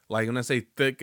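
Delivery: ordinary talk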